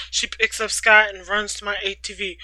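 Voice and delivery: plain reading voice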